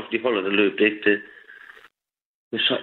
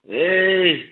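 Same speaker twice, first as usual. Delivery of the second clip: mock outcry; 6 semitones higher than usual. Passenger imagining cyclists in the rear-view mirror, mock outcry. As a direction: mimic a cyclist's indignant call.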